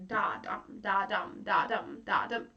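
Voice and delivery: chanting da dum; iambic da dum. Shown as a demonstration. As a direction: tap out the iambic beat, weak then strong. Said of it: "So it's like da dum".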